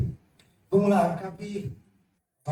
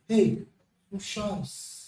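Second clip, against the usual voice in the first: soft hushed comfort; 4 semitones lower, 6 dB quieter, much slower than usual. Acted. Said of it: "nós chegarmos num velório".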